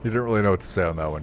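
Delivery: ordinary talk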